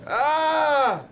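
high, loud shriek of alarm; startled shout. Real, not acted. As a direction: yelp like something lunged at you. Startled yell at passerby.

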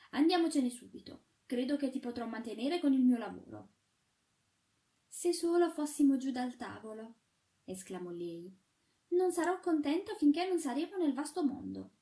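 a hint of the shepherdess, urgent. A narrator's reading. Shepherdess urging the sweep to go, lightly girlish.

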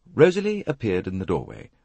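ordinary delivery

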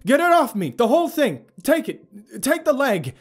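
frantic high yelling; desperate shouted demand. A narrator's reading. Wounded narrator orders Abigail to remove his leg, yelling desperately. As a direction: yell each order at full force. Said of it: "I demanded".

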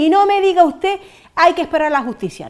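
más alto y más fuerte al cortar; no le deja hablar; protesta exaltada. Real, not acted. (corta al entrevistador en voz alta)

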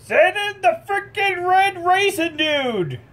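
slow, high hype announcement; announcer shout. Acted. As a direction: bellow it like a ring announcer. Announcing a hero's entrance.

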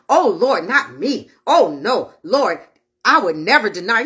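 low-pitched, imploring denial; low mock-solemn protest; protesting disciple. Peter protests again to Jesus.

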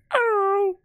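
very high, stretched falsetto hello; taunt impression. Gamer trying to mimic a taunt, falsetto impression. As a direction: squeal it out as an impression. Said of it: "That's all he does".

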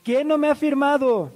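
high-pitched calling out. Retold or imitated. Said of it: "subo la voz y digo".

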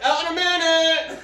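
high, loud call through the door; yelling from the stall. Retold or imitated. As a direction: shout to the person knocking.

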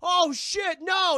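high, loud panicked yell; panicked shout. Retold or imitated. Khan sees Brie Larson fall, yelling in panic. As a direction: shout in horror. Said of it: "He's like".

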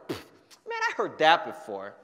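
scoffing, over it; arms-crossed eye-roll voice; dismissive scoff. Shown as a demonstration. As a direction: brush it off with a pfft. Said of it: "cross your arms like this".

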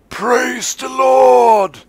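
drawn-out high triumphant cry; joyful shout. Acted. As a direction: belt it like a hallelujah. Gleeful about service shutting down.

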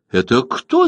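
usual speaking voice

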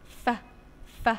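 isolated f sound, said twice; letter sound f. Shown as a demonstration. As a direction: breathe out ف alone, twice. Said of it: "صوت حرف الفاء". (نطق صوت ف منفصل)

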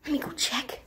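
soft hushed whisper; whispering while hiding. Real, not acted. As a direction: whisper so seekers miss you. Hiding while seekers near.

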